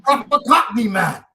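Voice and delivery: lightly voiced London Cockney, one high loud burst; playful Cockney accent quote; Cockney imitation. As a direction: mimic English friends praising in a Cockney accent. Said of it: "and they were like".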